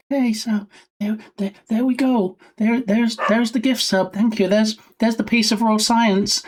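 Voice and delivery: a hint of a pleased streamer thanking chat; mock streamer voice. Streamer thanking for a gift sub, lightly mocking.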